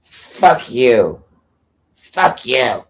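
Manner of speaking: loud put-on voice of a rude woman; hammed-up hostile impression; mocking impression of her. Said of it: "No, she goes".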